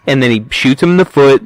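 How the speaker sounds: usual speaking voice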